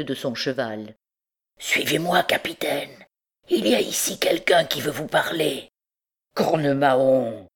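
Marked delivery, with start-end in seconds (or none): 1.6-5.7 strange, sepulchral hunchback's voice; sepulchral messenger. A narrator's reading. Hunchback asks the captain to follow him, sepulchral. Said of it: "à la voix sépulcrale de l'étrange messager". 6.3-7.5 slow, grumbling oath; grumbled oath. A narrator's reading. Captain curses at a stranger stopping his horse, grumbling. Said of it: "grommela Phébus".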